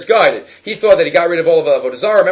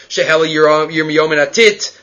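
ordinary talk

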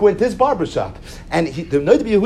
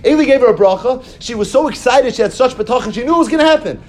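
excited retelling; 6 semitones higher than usual. Retold or imitated. Chana's joy at the blessing.

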